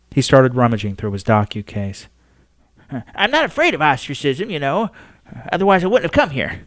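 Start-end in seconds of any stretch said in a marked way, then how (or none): 3.1-6.6 higher, quick insistence; determined old man. Acted. Astronomer insists to the Pope he fears nothing, higher. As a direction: prove he is not afraid.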